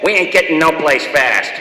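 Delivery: loud Stooge character voice; Moe. Acted. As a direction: punch it out like a bossy Stooge. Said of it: "And Mo had".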